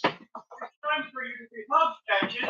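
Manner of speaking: high, soft cooing; tender pet talk. Real, not acted. Owner softly coos to Patches that she'll be loved, high and gentle. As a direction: murmur it lovingly to the dog.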